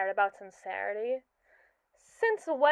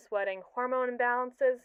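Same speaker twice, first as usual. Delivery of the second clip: usual speaking voice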